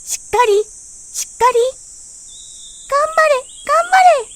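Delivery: very high loud cheering. A narrator's reading. Sun, rain, wind and bird cheering the bud, very high loud cheers.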